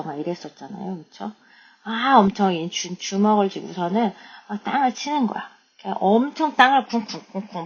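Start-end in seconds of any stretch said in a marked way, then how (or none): none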